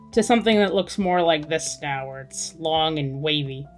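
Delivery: ordinary delivery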